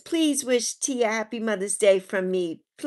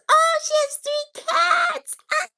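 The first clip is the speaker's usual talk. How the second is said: very high, loud, drawn-out delighted squeal; excited squeal; 5 dB louder, slower than usual. Real, not acted. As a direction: squeal with delight over the cats. Excited reaction to viewer's cats.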